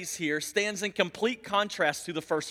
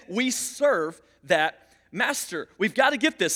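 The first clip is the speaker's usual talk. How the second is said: ordinary delivery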